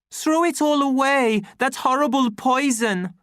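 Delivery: very high, loud exhortation; impassioned cry. A narrator's reading. Savage urging deltas to discard soma, impassioned cry. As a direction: urge the whole crowd urgently. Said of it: "An angry cry went up".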